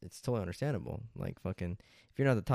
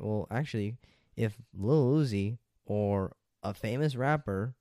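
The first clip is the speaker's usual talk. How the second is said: usual speaking voice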